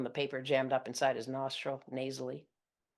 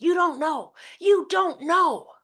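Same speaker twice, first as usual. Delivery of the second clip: high, loud, slow taunting shriek; mom still shrieking; 12 dB louder, slower than usual. Retold or imitated. Mother shrieking on as her daughter tries to hang up. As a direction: drag out the accusation. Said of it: "as Mom continues".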